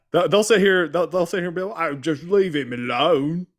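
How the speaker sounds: high, loud mock accent that comes out British; accidental British accent; slipping British accent. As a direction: try Aussie, land on British. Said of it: "I'm still doing British".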